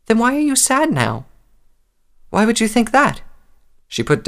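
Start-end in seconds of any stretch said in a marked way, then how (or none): 0.1-1.2 high, plaintive filly voice; little child. A narrator's reading. Little filly asks her mother about sadness, childlike. 2.3-3.2 put-on high, sweetened voice for a child; gentle motherly deflection. A narrator's reading. Mother questions her worried filly, sweetly deflecting.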